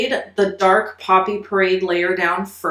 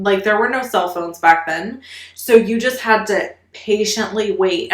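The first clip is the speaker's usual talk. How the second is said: ordinary talk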